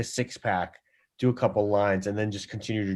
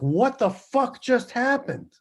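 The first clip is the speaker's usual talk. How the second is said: much higher, slow stunned question; mock bewilderment; 12 semitones higher, slower than usual. Producer left alone after the guitarist storms out, mock stunned. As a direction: sound utterly baffled.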